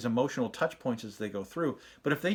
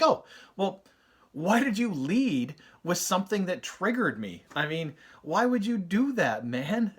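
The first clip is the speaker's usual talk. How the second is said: exasperated dad; 5 semitones higher, slower than usual. Retold or imitated. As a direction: whine at the kid for baiting you. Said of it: "all of a sudden I'm triggered".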